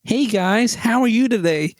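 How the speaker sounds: put-on American accent. American guy greets strangers in a pub, mock American accent. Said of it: "this American guy comes up to us".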